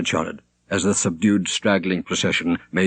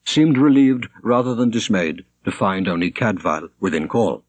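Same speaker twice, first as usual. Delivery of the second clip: ordinary delivery, nothing marked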